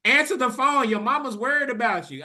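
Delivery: loud high yell at the gate; frantic yell to an unanswering brother. Retold or imitated. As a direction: shout it at the closed gate. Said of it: "I'm yelling".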